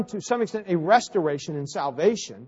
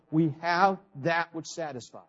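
ordinary talk, nothing marked

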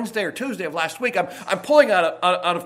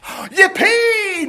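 gasp, then high drawn-out whoop; loud cheer; 11 semitones higher, 5 dB louder than usual. Acted. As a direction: cheer in hammed-up glee. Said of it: "I don't want quite that loud".

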